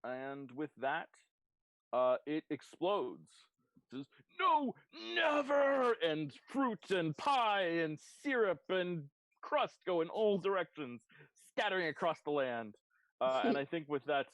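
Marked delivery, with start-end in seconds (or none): 4.4-5.9 high, loud villain's last cry; big, high-pitched dying shout; defiant death cry. Acted. Defeated ooze explodes crying out. 6.0-12.8 loud, high, drawn-out scene description; big climactic game master voice; explosive narration. A narrator's reading.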